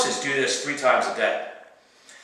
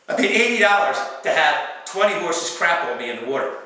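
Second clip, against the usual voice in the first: ordinary delivery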